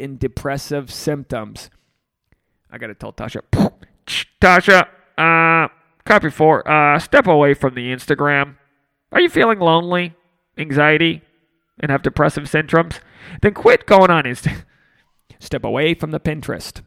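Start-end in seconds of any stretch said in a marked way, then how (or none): 4.4-14.6 mock radio call. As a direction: play dispatcher ordering someone to step away.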